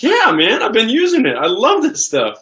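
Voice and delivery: very high, over-earnest protest; playfully wounded, pitch way up; mock upset. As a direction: insist in hammed-up distress. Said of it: "you really seem upset about that".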